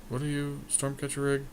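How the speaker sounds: ordinary delivery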